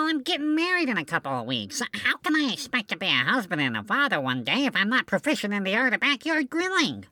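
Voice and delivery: normal talking voice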